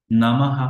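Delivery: নমঃ as "na-ma-ha"; visarga as h. Shown as a demonstration. Teacher showing the visarga to learners, নমঃ with final h. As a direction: sound the end of নমঃ as ha. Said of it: "হয়ের মতো উচ্চারণ করতে হয়".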